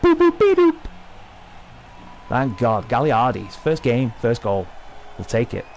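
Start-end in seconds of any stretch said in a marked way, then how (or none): 0.0-0.8 very high, loud robotic boops; goofy beeping. Pretend. Gamer celebrating his goal with very high, loud boop noises. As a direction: beep it out like a silly victory jingle.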